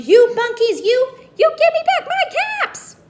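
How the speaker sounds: very high, very loud screaming demand; furious shout. Acted. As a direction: scream it at the top of the lungs. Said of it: "and shouted".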